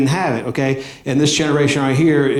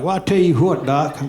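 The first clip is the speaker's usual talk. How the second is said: put-on Southern accent; 2 semitones higher than usual. Dad answering the doctor, in a Southern accent. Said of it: "I'm giving him a Southern accent".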